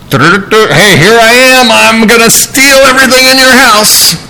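high mock thief announcing himself; brash thief. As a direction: play a thief who shouts his arrival.

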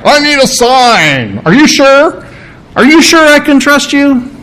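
lightly voiced skeptic begging for proof; doubting Zacharias. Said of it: "Like Zacharias".

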